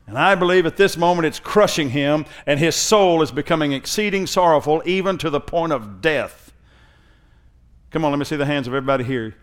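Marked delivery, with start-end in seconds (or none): 0.1-6.5 high, loud, building preacher cadence; full-force preaching. Acted. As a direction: preach it with rising intensity. Preaching continues.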